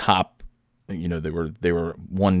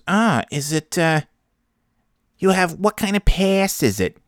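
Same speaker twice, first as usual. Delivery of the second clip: high-pitched, hammed-up New Hampshire accent; 11 semitones higher than usual. Trampoline park clerk asking a customer about passes, exaggerated New Hampshire accent. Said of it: "she's got, like ... trampoline park New Hampshire accent".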